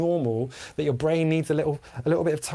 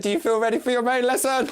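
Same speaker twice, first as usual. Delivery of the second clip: "Do you feel ready for your main lesson?" higher and louder; louder repeat; 8 semitones higher, 3 dB louder than usual. Shown as a demonstration. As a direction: repeat the question louder to rouse the class. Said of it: "I said".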